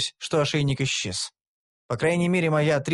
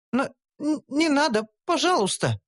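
much higher, stammering frightened plea; bleating plea; 11 semitones higher than usual. A narrator's reading. Gnome begging them to stop, frightened bleat. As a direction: bleat it out timidly. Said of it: "проблеяла Шантри".

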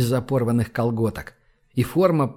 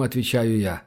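ordinary delivery, nothing marked

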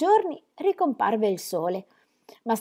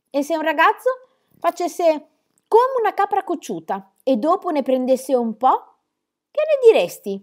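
stern mock scolding; 8 semitones higher, 6 dB louder than usual. A narrator's reading. Grandfather scolding Peter for skipping school, mock stern. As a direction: shame the little general with irony. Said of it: "grandfather questioning Peter".

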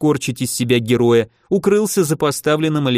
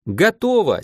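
called out; 7 semitones higher than usual. A narrator's reading. Alexey to his partner mid-battle, calling out. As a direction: call out that it's done. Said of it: "крикнул Алексей".

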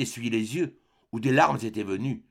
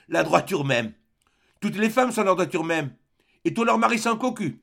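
bitter mocking echo, rising higher to the end; 7 semitones higher, 4 dB louder than usual. A narrator's reading. Pierre mocks his mother's words, bitter and scornful. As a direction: throw her words back with scorn. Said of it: "Il se mit à rire tout à fait".

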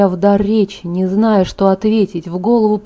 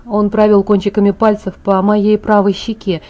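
ordinary delivery, nothing marked

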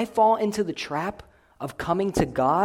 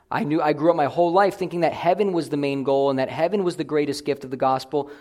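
ordinary talk, nothing marked

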